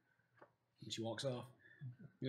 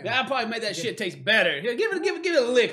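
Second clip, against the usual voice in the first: taunting dwarf; 5 semitones higher, 6 dB louder than usual. Acted. Dwarf pushing his elbow.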